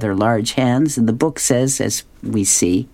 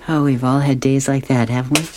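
ordinary talk

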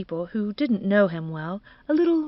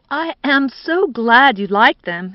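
slow, louder, emphatic thanks; effusive young man; 4 semitones higher, 8 dB louder than usual. Acted. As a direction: draw out the gratitude. Eddie answers in his effusive manner.

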